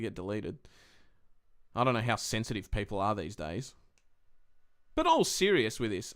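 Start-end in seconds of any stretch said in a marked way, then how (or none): none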